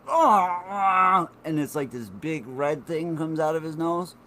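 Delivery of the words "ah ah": imitating Arnold. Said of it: "and he's like".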